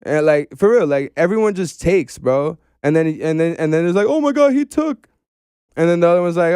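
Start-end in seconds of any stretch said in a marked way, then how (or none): 4.1-5.1 high-pitched mimicked indignation; mock outrage. Podcast guest mimicking people who complain, mock outrage. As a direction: mimic a scandalized onlooker, pitched way up.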